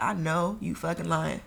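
slow, soft, flat disbelief; quiet disbelief. Retold or imitated. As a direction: mutter it under your breath. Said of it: "I was like".

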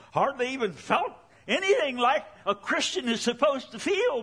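high, strained retelling with mounting urgency; anguished testimony. Retold or imitated. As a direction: press the confession out high and fast. Acts out friend's anguished testimony.

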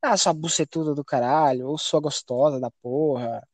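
low, lazy mock insults; joking taunt. Player starting to mess with his teammate, low joking taunts. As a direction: tease with insults that slide downward. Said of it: "aí eu comecei a zoar".

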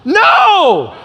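high, loud cry of dismay; mock dismayed outcry. Father crying out at son's wrong answer, mock dismay. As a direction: pretend shock at the answer. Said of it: "kinda raised my voice a little bit".

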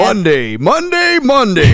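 booming monster truck ad voice; loud, high soaring announcer call. Pretend.

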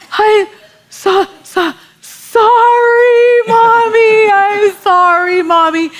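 put-on very high, loud, wailing child voice; sobbing toddler apology. Toddler sobbing an apology to mommy, high and wailing. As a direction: wail it out like a tearful little girl. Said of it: "She can't even get it out".

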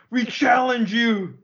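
slow, high, theatrical challenge; slow dramatic challenge. Acted. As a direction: issue a hammy duel challenge. Mock challenge, calling out.

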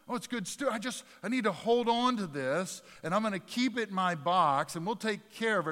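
high, loud whiny excuse of a hoarder; mock whiny hoarder. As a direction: ham up the greedy hoarder clinging to stew.

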